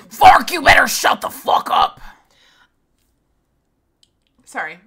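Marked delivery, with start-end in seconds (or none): 0.0-2.0 full-volume outburst; furious mock shout. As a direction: yell it harshly at the heckler. Mock outburst at a chat insult.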